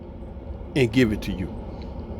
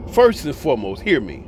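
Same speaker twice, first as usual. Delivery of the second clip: ordinary delivery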